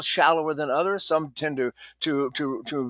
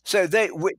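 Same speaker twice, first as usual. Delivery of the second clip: ordinary talk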